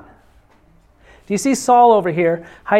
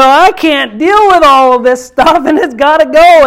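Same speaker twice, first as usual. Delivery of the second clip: fed-up traveller; 7 semitones higher, 9 dB louder than usual. Retold or imitated. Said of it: "when you go".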